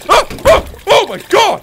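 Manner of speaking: real high, loud shrieks; startled scream. Real, not acted. Player startled by sudden game noise, screaming. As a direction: jump with loud high yelps.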